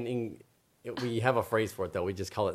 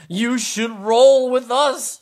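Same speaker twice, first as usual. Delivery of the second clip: high, slow sing-song zebra; 13 dB louder, slower than usual. A narrator's reading. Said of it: "said the zebras".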